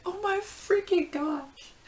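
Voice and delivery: very high, drawn-out squeal; small, slow, overwhelmed squeak; starstruck fangirl. Retold or imitated. Fan asked her name by the singer, overwhelmed.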